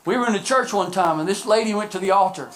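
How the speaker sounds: ordinary talk, nothing marked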